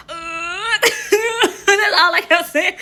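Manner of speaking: high laughter through the words; laughing. Real, not acted. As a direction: crack up at your own awkwardness. Laughing at own awkwardness.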